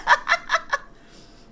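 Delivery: airy wheezing laugh; breathless laugh. Real, not acted. Laughing at the video.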